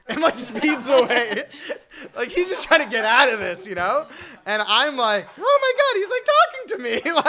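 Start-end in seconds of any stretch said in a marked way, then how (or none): 5.4-7.2 very high, laughing excited squeal; starstruck squeal. Retold or imitated. Fan realizing Larry King is talking to him, squealing. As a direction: squeal with giddy disbelief.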